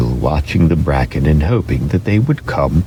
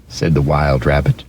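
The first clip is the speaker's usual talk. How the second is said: plain reading voice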